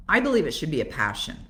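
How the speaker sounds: ordinary delivery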